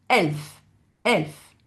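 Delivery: "elf" with a glottal stop before the E. Shown as a demonstration. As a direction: attack the E of "elf" from a closed throat. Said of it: "ce qu'on appelle le coup de glotte". (« elf » avec un coup de glotte)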